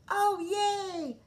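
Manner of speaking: high, loud cheer; Froggy cheering about the trip; excited cry. A narrator's reading. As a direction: cheer with delight. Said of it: "cried Froggy".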